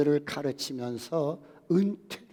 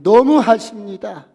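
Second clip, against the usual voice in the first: 너무하십니다 cried out louder and higher, falling; a grieving mother crying out 너무하십니다; loud lament; wailing plea; 5 semitones higher, 13 dB louder than usual. A narrator's reading. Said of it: "통곡을 하면서 뒹굴면서".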